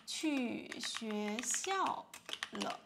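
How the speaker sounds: 去学校 spoken very slowly and clearly; teacher modelling the answer slowly; slow model answer. Shown as a demonstration. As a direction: read it out slowly for learners. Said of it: "他，啊".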